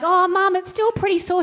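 high, timid plea to Mum; small hurt child asking again; whimpering kid. Retold or imitated. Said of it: "I'm like".

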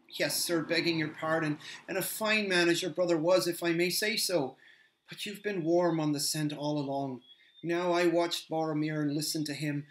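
put-on an earnest young hobbit gushing about the Lady; Sam, eager hobbit. Sam explains, higher.

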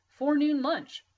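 loud high yell running off. A narrator's reading. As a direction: holler it back over your shoulder. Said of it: "he yelled back at me".

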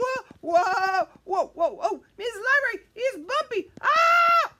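high, loud playful wobble cries; bumpy ride. Pretend. Storyteller rides the bumpy pretend carpet, hammed-up loud cries. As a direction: ham up being tossed about.

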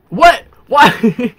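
sudden loud high "what?"; surprised outburst. Real, not acted. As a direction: blurt it out in disbelief. Baby dino won't wake up.

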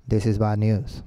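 low monotone read-out; lifeless wrong way. Shown as a demonstration. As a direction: drone it as if it meant nothing. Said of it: "No, así no". (monótono y grave, sin emoción)

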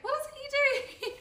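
very high, self-mocking squeak of a question; hammed-up naive asking; mock-clueless. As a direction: send your pitch sky-high, mocking your own question. Repeats her naive question, mocking herself.

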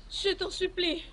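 usual speaking voice

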